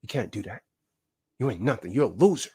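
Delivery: hushed, low taunting inner voice; inner voice whisper. Retold or imitated. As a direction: murmur it like doubt in your head. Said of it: "that inner voice that's gonna whisper to you".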